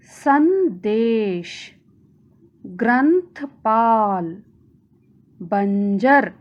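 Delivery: each word drawn out slowly with its nasal clear; slow model reading. Shown as a demonstration. Teacher to pupils, slow word-by-word reading. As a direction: read संदेश, ग्रंथपाल, बंजर slowly, one by one. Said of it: "अनुस्वार वाले कुछ शब्द पढ़ेंगे".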